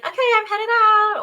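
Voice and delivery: high, loud call through the house. Acted. Someone leaving home announces it to the household, calling out.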